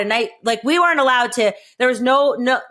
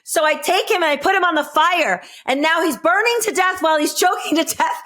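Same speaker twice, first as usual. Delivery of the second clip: ordinary talk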